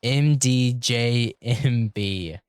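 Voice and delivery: loud, stretched-out letters; slow, loud spelling. Real, not acted. Spelling out letters for a listener, slow and loud.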